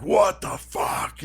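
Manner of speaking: slow, high-strained mock bellow; hammed-up, stretched cry of outrage; booming voice. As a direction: imitate a big booming shout, drawn out. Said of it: "really deep booming fucking voice".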